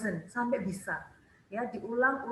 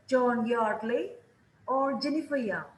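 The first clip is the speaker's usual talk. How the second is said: put-on slow rise on "John Yardley", fall on "Jennifer Young"; teacher modelling the choice tune; rise then fall. Shown as a demonstration. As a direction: stretch the first choice upward, let the second fall. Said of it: "Pilihan pertama, nadanya naik".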